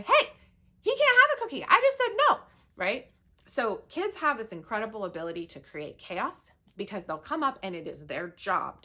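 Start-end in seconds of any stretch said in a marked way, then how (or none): 0.0-2.4 loud, high exasperated holler. Retold or imitated. Mom hollering at Dad over the cookie, loud indignant yell. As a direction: holler across the house in protest. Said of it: "I'm hollering at Dad".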